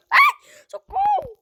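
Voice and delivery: very high, loud scream for help; panicked scream. Real, not acted. Gamer cornered by three enemies, screaming high for help. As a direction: shriek for help.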